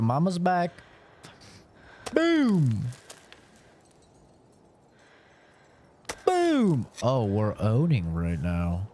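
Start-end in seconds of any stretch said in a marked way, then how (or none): 2.1-6.9 triumphant boom. Real, not acted. Triumphant move in game.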